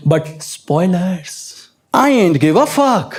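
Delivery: dismissive drawl. Retold or imitated. Girlfriend quoted dismissive.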